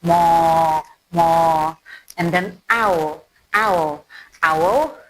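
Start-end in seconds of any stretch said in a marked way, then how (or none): 0.0-1.7 cow moo. Shown as a demonstration. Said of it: "you know the cow".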